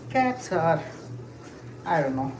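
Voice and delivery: ordinary talk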